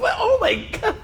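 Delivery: high, laughing oh my God; shocked. Retold or imitated. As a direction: gasp at the price, laughing. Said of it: "I think it's normal reaction".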